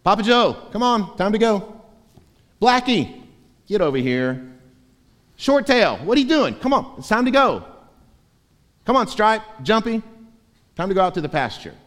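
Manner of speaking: high, loud call across the field; calling the sheep. Acted. Shepherd calling his sheep out by name, high and loud.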